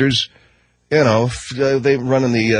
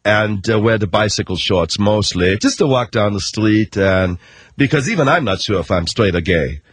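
put-on lower, thick German accent for a pop star; lazy German-accented pop star boasting; 3 semitones lower than usual. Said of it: "Like Milli Vanilli?".

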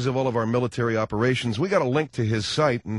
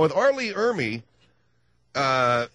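ordinary delivery

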